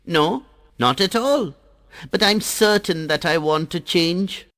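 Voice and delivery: put-on high, sweet child voice, flattering; butter up the Sun in a high bright voice; little water drop sweet-talking the Sun, voiced high; Jal the water drop.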